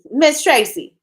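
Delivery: called out high and loud to a viewer; delighted shout of her name; excited call-out. Real, not acted. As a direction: hail Miss Tracy with a laugh. Calling out to a viewer.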